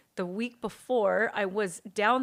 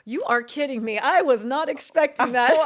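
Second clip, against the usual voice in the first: stunned disbelief; 4 semitones higher, 8 dB louder than usual. Retold or imitated. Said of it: "And I said".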